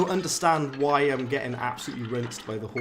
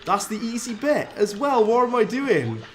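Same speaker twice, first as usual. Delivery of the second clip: higher, louder, laughing disbelief at himself; exasperated outburst; 6 semitones higher, 4 dB louder than usual. Real, not acted. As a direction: rant at your own bad play, half laughing. Frustrated gamer losing boss fight.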